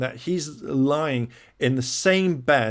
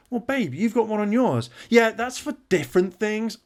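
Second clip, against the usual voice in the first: mock couple bickering; 9 semitones higher than usual. Acted couple argument.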